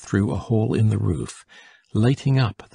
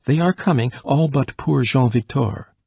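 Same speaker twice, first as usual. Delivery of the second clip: higher, pressing report; urgent grief; 5 semitones higher, 4 dB louder than usual. Acted. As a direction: deliver bad news urgently. Soldier reports comrade's death.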